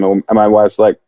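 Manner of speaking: ordinary talk, nothing marked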